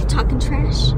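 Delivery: loud teasing yell; playful shout. Real, not acted. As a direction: call it out teasingly. Yelling playfully at friend.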